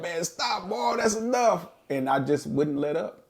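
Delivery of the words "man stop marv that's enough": laughing plea. Retold or imitated. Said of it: "Kat was laughing like".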